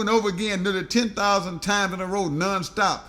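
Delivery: ordinary delivery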